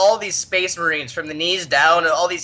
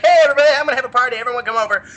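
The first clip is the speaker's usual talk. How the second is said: fast, loud, higher hey; Slaanesh throws a party, fast and loud; party kid inviting; 5 semitones higher, 4 dB louder, much faster than usual. Retold or imitated. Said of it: "He's like".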